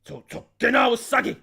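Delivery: loud, dramatic name shout in reversed order; samurai movie style. Shown as a demonstration. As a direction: call "Tsukina Usagi!" like a film samurai. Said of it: "like it's a samurai movie".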